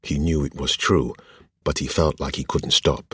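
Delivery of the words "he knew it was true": ordinary delivery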